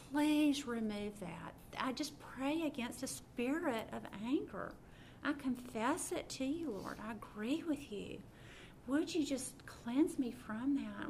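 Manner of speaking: sincere prayer read with rising intensity; heartfelt prayer. Acted. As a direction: pray it straight to the Father, imploring. Prays aloud at length.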